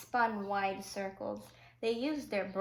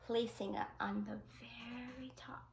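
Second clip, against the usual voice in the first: soft unvoiced whisper; whispered narration as the boy builds; 7 dB quieter than usual. A narrator's reading. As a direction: breathe it secretly as he places the block. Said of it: "An whispered".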